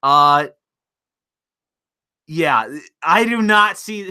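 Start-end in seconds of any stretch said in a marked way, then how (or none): none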